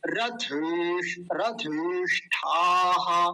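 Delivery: slow syllable-by-syllable रथेष्ठाः, every sound held. Shown as a demonstration. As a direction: draw out रथेष्ठाः carefully. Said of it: "मैं बहुत धीरे धीरे बोल रहा हूँ". (रथेष्ठाः बहुत धीरे-धीरे, हर अक्षर स्पष्ट)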